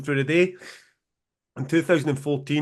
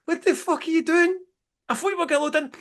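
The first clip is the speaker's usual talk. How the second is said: much higher, incredulous outburst; shocked disbelief; 11 semitones higher than usual. Retold or imitated. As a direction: squeak it out in stunned disbelief at a naked man. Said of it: "So I'm like".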